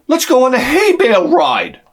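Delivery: put-on high, eager kid voice; excited like a kid. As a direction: bounce it out like a thrilled child. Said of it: "now I'm like a kid".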